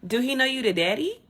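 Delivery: high, loud disbelief; incredulous. Real, not acted. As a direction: pitch jumps up in outrage. Outraged rhetorical question.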